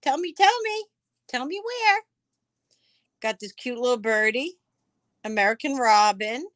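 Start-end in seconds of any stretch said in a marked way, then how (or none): 0.0-2.0 much higher, sing-song begging; playful pleading. Real, not acted. Seller begging a viewer where the butterfly is, high and pleading. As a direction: coax the answer out, teasing.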